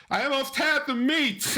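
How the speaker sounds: high-pitched, strained mock wail; mock anguished husband. Husband confessing to his wife, mock anguished. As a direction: wail it as a pitiful excuse.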